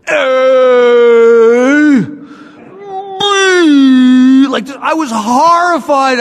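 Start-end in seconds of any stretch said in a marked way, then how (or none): 0.1-4.5 slurred, loud, wailing letters; drunken ABCs. Shown as a demonstration. Reciting the alphabet drunk for the police camera. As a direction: stretch each letter out like a sloppy drunk. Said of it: "You know how I did the A B Cs?".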